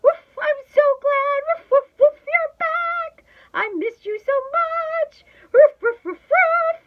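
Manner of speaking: ecstatic dog, very high and loud; squealing joy broken up by woofs; excited barking. A narrator's reading. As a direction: bark out a gushing welcome. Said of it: "I bark, jumping and leaping".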